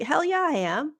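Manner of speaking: high, perky yes; mock triumphant reply. Answering the friend's praise with a high, perky cheer. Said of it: "It's like".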